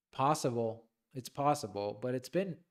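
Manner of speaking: hoarse voice giving out; losing voice. Real, not acted. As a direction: voice cracking and fading. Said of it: "like, losing my voice".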